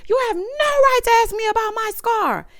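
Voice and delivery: high-pitched mock outraged cry; high, sustained angry protest, acted out; pissed off. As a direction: imitate an indignant woman warning someone off. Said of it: "she was pissed".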